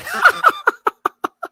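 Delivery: a high burst of laughter. Real, not acted. Loud laugh.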